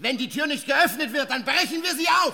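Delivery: furious shouts through the door; shouted demand at the locked door; angry shouting. Acted. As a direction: bang on the door and yell. Husband breaks in on wife.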